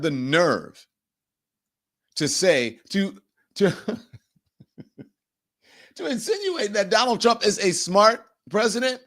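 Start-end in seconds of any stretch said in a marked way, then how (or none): 6.0-9.0 incredulous. Real, not acted. Mocking disbelief at Vance.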